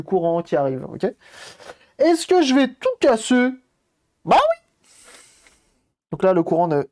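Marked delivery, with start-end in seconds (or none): 2.0-4.9 much higher, louder joking answer; mock alarm. Streamer teasing himself about breaking the console, mock squeal. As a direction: squeal yes like a cartoon. Self-mocking fear of breaking.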